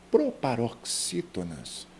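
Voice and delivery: "Proparoxítonas" slowly spelled out, weight on XÍ; stressed XÍ. Shown as a demonstration. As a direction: draw out "Proparoxítonas" for the class. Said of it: "Todas são acentuadas". ("Proparoxítonas" devagar, com XÍ tônico)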